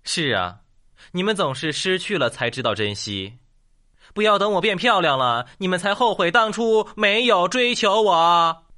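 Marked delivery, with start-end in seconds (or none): 4.1-8.6 high, louder mock reproach; hammed-up scolding of would-be suitors; mock-indignant appeal. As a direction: declaim it as a comic warning, building to the end. Mock plea as punchline, raised voice.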